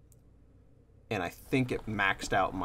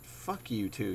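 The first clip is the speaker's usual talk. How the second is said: ordinary talk, nothing marked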